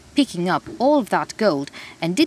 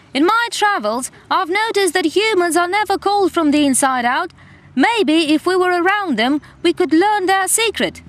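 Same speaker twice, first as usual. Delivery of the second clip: yelled; 11 semitones higher, 5 dB louder than usual. Acted. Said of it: "yelled the otter".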